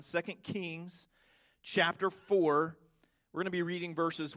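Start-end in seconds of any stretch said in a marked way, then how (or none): 0.0-2.8 slow, word by word so they can write; slow dictation. Shown as a demonstration. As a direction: spell out the reference slowly for note-takers. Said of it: "I encourage you to write it down".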